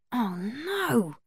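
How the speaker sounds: soft, dismayed thought to oneself; hushed dismay, drawn-out "no"; inward thought. A narrator's reading. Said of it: "thought Xander".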